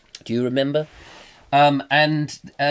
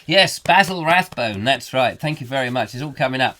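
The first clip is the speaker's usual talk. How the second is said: normal talking voice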